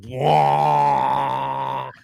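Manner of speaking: mock didgeridoo blast "wah"; didgeridoo wail. Imitating the drunk's didgeridoo, mock droning blast. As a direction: imitate a blaring didgeridoo note. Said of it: "wailing on this didgeridoo, like".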